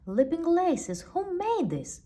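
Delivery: high, loud amazed cry; marveling. Acted. Shopkeeper astonished at magic shoes, high and loud. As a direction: Stan gasps at the shoes in wonder. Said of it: "marveled Stan".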